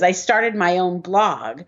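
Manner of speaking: ordinary talk, nothing marked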